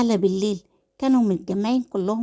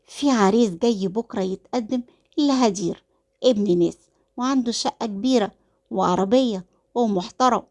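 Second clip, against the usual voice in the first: ordinary delivery, nothing marked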